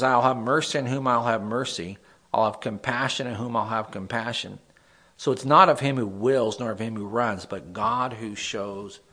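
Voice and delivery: loud, emphatic Bible reading; scripture reading. Acted. Pastor reads Romans to the group, emphatic reading. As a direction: proclaim the verse with weight.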